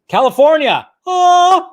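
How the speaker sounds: loud, high cheer with a whoop; overjoyed. Real, not acted. Californian cheering that movie theaters are reopening, overjoyed. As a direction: shout it out in celebration. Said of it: "I'm so happy".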